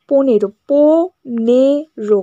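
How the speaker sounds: পনেরো drawn out very slowly, syllable by syllable; slow syllable count. Shown as a demonstration. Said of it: "পনেরোকে বলতে গেলে দেখো কেমন লাগছে".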